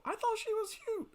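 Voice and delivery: squeaky high gush over a crush; giddy high-pitched swoon. Acted. Gushing over a childhood crush.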